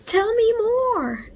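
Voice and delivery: very high, slow, gleeful plea; a thrilled little boy begging; high and drawn out with glee; gleeful shout. A narrator's reading. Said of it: "Hafiz shouted gleefully".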